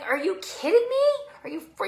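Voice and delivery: high-pitched, acted disbelief; incredulous outrage. Client answers trainer asking how she feels, acting incredulous. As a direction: squeal it in mock indignation.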